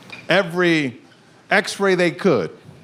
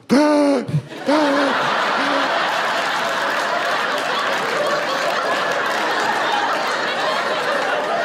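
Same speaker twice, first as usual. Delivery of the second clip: long breathless mock gasping; son's mock gasp; 4 semitones higher than usual. Three-year-old son mocking his dad's gasps, breathless. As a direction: imitate the toddler gasping for air. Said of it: "looks at me and goes".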